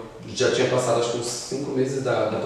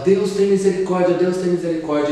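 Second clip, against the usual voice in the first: desperate plea; 7 semitones higher than usual. Retold or imitated. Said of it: "eu começava a chorar, desesperado".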